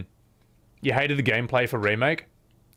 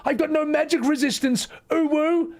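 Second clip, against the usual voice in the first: high, panicky mock cries for help; fluttering cries for help. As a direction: squeal for help like a frightened hero. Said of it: "Superman's gonna be like".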